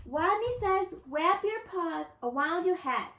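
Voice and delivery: high little voice, a bit bolder; a small rodent calling out the game; timid rodent voice. A narrator's reading. Wadney leads Simon Says.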